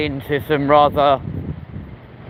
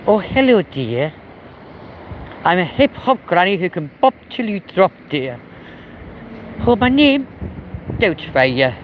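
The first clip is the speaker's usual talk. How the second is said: Missus Doubtfire impression; 3 semitones higher, slower than usual. Streamer doing a Welsh granny for chat, Mrs Doubtfire style. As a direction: ham up a sweet old granny. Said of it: "sound something close to, like, Missus Doubtfire".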